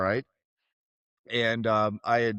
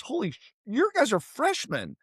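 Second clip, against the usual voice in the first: high, sudden shock; shocked realization; 11 semitones higher than usual. Retold or imitated. Realizing the giants are freshmen, shocked high voice. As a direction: voice jumps up in alarm.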